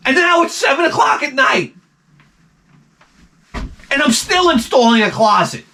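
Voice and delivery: overworked carpenter exploding in his head; angry rant. Retold or imitated. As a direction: build from high griping to a strained shout. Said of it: "And I started to get so angry".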